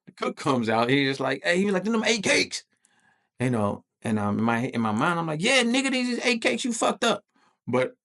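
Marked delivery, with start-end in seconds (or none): 1.8-2.6 high, raised-voice imitation of the cook; mock holler; cook calling out. 5.4-7.2 high-pitched, indignant comeback; acted-out exasperated yell; angry retort. Imagined angry retort to cook.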